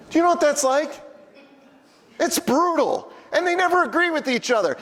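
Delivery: exasperated rant. Real, not acted. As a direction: vent about the two governors. Venting about two governors.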